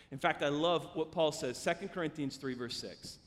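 usual speaking voice

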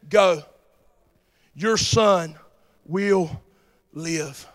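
low, slow voice of authority; lightly voiced Jesus, low and deliberate; measured pronouncement. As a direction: pronounce it in separate, weighty pieces. Preacher voicing Jesus' pronouncement.

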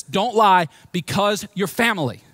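slow, loud preaching of the verse; emphatic proclamation. Acted. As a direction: proclaim it slowly to the church. Preacher quotes Paul emphatically.

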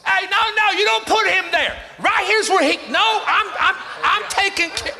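high, loud, urgent protest; acted eager shouting. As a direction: holler over everyone to claim the customer. Acts restaurant worker calling out.

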